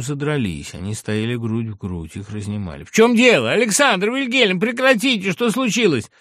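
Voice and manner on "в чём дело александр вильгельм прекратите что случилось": high, loud urgent shouting; agitated raised-voice questioning; alarmed intervention. A narrator's reading. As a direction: shout at two friends to stop. Friends break up a quarrel.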